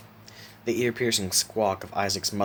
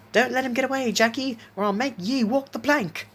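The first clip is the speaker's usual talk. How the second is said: put-on very high, egging-on pirate kid voice; antagonizing child pirate; 4 dB louder than usual. A narrator's reading. Isaac eggs Jackie on against the cat, high and taunting. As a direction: goad your mate in a high pirate squeal. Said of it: "Isaac antagonized".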